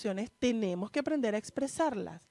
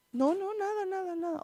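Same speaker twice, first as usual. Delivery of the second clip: lightly voiced father, higher, brushing it off; dad waving it away, a hint of higher pitch; father's denial; 7 semitones higher than usual. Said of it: "pero lo ves llorando".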